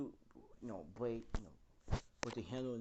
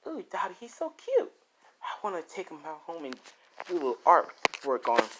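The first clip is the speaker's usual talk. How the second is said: put-on high, gushing girlish voice, much louder; cutesy Toga; 7 semitones higher, 14 dB louder than usual. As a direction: coo it like an infatuated girl. Toga swooning over Dobby.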